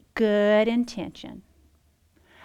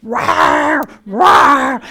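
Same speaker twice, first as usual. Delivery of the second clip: loud roaring growl like a hungry stomach; 2 semitones higher, 12 dB louder than usual. Retold or imitated. Telling the women how her hungry stomach growled, loud roar. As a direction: shout out the stomach's rumble as a beast. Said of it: "my stomach was going".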